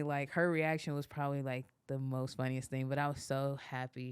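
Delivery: ordinary talk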